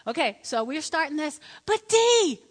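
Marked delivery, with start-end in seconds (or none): 1.7-2.4 high, loud mock plea; imitated protesting husband; pleading husband. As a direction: whine it insistently, pitched up.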